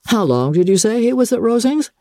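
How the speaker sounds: feigned indifference in a lifted voice; affected indifference. A narrator's reading. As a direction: ask as if it hardly matters. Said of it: "With an air of indifference".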